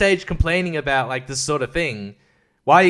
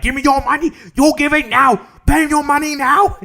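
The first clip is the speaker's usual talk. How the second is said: put-on high-pitched, loud caricature of a Chinese accent; demanding robber in a put-on Chinese accent; mock Chinese accent; 11 semitones higher, 5 dB louder than usual. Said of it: "in that Chinese accent".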